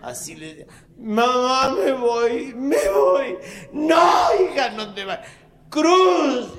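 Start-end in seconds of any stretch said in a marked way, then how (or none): 1.0-3.4 mock soap daughter. 3.7-5.3 mother crying out. Acted. Mother cries out. 5.7-6.6 calling out. Acted. Mother calls husband.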